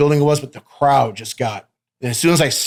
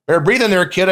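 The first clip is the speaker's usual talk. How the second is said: ref warning; 6 semitones higher than usual. Retold or imitated. Referee warns the fired-up wrestler, mock coaching call. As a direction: call it out to a winded kid. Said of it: "Kobe called me out and he goes".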